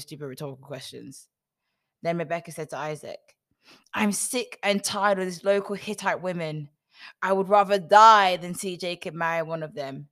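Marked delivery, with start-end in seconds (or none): 3.9-10.1 loud, fed-up outburst; dramatic griping, raised and forceful; exasperated complaint. Acted. Acted complaint of Rebekah to Isaac.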